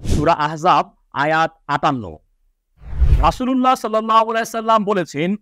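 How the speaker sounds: ordinary talk, nothing marked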